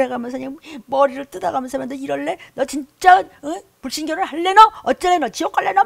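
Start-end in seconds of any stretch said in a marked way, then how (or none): 1.9-5.8 loud high scolding of the flock; yelling scolding. Retold or imitated. Pastor yelling threats at his congregation, loud scolding. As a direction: yell at them like a furious pastor. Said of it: "소리소리 질러가면서 막 욕을".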